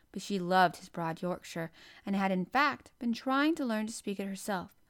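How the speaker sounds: ordinary delivery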